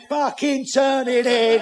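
high, loud, drawn-out complaint; protesting lion. Acted. Lion objecting to the gorilla, high and drawn out. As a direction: stretch the words in protest.

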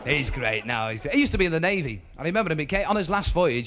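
ordinary delivery

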